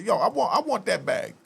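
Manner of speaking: hammed-up stick-up demand; mock threatening demand. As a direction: lean in like you're shaking him down. Robber demanding money, acted out.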